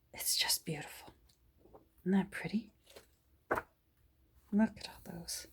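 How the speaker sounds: soft admiring whisper. Real, not acted. Showing the camera the illustrations, whispered. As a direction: breathe it out in awe.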